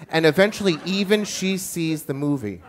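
usual speaking voice

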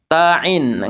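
Ta'in with the ta lengthened before ain; ta and ain separated. Shown as a demonstration. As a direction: hold ta, then begin ain in "Ta'in". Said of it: "pisahkan ta sama ain ini". (Ta'in, ta panjang lalu ain)

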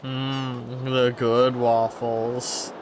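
drawn-out, relishing echo; slow savoring. Pretend. Player savoring the good waffles, slow and relishing. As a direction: linger slowly over each word.

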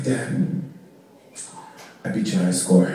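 low, soft deadpan punchline; kid's quiet confession. Retold or imitated. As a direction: drop the voice to break the news. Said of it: "He's like".

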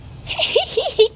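high tittering hee-hee; impish giggle. Acted. Ted asked about dressing up, high giggle. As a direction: giggle as a storybook character. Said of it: "Says Ted".

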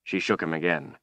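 plain reading voice

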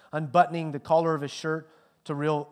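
usual speaking voice

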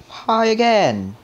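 high, loud, drawn-out cry; loud shriek that drops at the end; startled yell. Real, not acted. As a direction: yell it as the scare hits. Jump scare, monster reappears.

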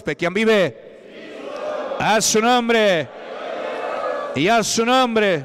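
gritado fuerte y alto, despacio; grito de culto. Real, not acted. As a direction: lanza el llamado a todo pulmón. Preacher calling out to congregation. (el pastor llama a la iglesia, gritando fuerte y alto)

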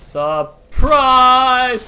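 loud, high gleeful yell; spring the ambush with a shout; surprise shout. Real, not acted. Player yelling surprise at enemy ambushed.

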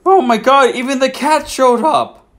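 high gasping disbelief; delighted shock at the cat; gasping surprise. Real, not acted. Gasps as the cat appears.

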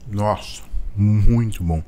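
savoring food slowly; mouth full, chewing. Real, not acted. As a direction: hum while chewing. Seen 1: bite taken at -1.0, eyes closed, closed-mouth chewing while humming.

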